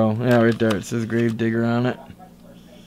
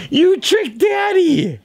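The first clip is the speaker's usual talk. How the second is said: mock outrage; slower than usual. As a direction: ham up being tricked. Play-fighting, mock outrage.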